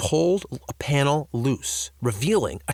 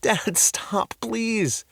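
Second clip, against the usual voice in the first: put-on high, begging boy voice; pleading boy; 10 semitones higher, much slower than usual. A narrator's reading. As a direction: beg him to stop. Jake covers his face.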